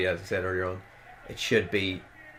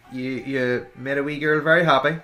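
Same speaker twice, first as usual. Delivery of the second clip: usual speaking voice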